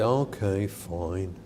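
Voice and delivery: low, grudging mumble; sulky kid. Retold or imitated. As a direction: give in reluctantly like a sulky teen. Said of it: "And they say".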